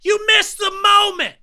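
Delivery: high shouted complaint from beyond; ghostly outburst. Retold or imitated. Ghost Davey scolding mourners for not laughing, high shout. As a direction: yell it like an offended ghost. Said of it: "my immediate reaction is".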